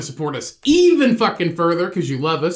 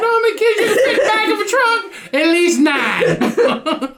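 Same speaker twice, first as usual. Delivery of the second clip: laughing shout; 11 semitones higher than usual. Pretend. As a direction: squeal it out through laughter. Mock punchline about kids in trunk.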